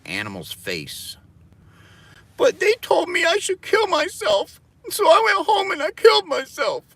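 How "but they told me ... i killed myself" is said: very high, loud, sobbing parody voice; squealing mock sob, much higher and louder; mock crying whine. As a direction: blubber it like a caricatured victim. Mocking a victim's whine.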